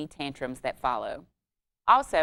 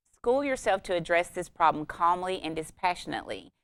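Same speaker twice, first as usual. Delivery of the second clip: normal talking voice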